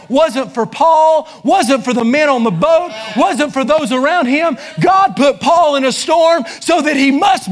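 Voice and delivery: preacher stirring the congregation, in full preaching voice; preaching at full force. Acted. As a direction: drive the point home from the pulpit.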